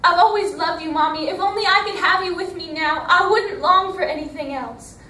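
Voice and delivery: high, loud pleading call to Mommy; crying out. Retold or imitated. Girl pleading with the heavens for her mother, crying out loud. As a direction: beg the heavens for your mother, full voice. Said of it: "She cried out".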